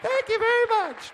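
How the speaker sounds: high character voice thanking the crowd; puppet voice thanks. Acted.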